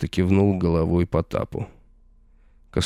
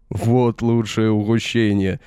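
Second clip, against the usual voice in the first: slow, raised triumphant cry; triumphant exclaim; 3 semitones higher, slower than usual. Acted. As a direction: crow over the stolen prize. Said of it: "торжествующе воскликнул он".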